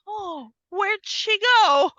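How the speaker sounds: slow, high, dopey mock confusion; mock puzzled killer. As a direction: play the clueless killer looking around. Killer searching window, mocked.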